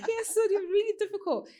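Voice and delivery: laughing. Real, not acted. Still laughing after story.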